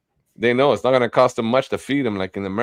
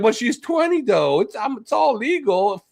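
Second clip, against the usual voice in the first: much higher mocking voice, pleading and defensive; mocking Ron as he defends his girlfriend, high and whiny; mock Ron whining; 10 semitones higher than usual. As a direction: squeak it out like a cornered creep. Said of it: "And he's like".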